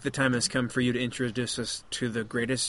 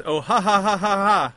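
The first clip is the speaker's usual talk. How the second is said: higher, louder spoken ha-ha; read-out laughter; 7 semitones higher, 5 dB louder than usual. Acted. Reading the book's laugh aloud to tease Dave, mock laughter. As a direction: recite the printed laugh as separate words. Reads out printed laughter from book.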